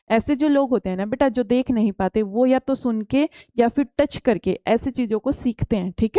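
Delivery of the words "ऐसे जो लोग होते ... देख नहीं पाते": ordinary delivery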